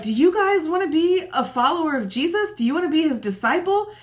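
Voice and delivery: high, fast teasing question; sarcastic question. Retold or imitated. Healed man teasing the Pharisees with mock invitation, sarcastic. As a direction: needle them with a cheeky offer.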